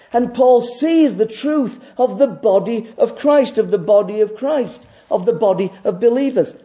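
fervent pulpit delivery; full-force preaching. Acted. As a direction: drive the point home to the congregation.

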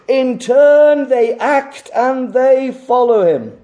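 high, loud pulpit proclamation; full-force preaching. Real, not acted. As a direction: proclaim it with rising urgency. Preaches at full force, challenging congregation.

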